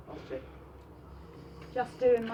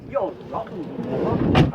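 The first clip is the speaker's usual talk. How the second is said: loud accusation; 2 semitones lower, 10 dB louder, much slower than usual. Real, not acted. Confronting the bailiffs.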